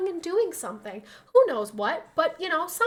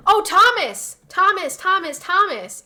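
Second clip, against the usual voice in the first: calling out; 7 semitones higher, 10 dB louder, slower than usual. Real, not acted. Calling out to top gifter.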